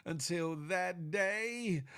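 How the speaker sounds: slow, high, stretched-out farewell; catchphrase sign-off. Pretend. Streamer signing off to his viewers, showy and drawn-out. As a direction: draw each word out, climbing to a big finish.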